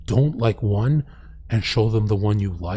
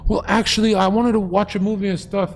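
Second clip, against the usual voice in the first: higher, sheepish mock girl; girly voice; 7 semitones higher than usual. Mocking the shook girl in the lot, high and sheepish. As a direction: pitch it up like a girl backpedalling. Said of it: "they're shook".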